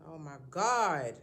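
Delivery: ordinary talk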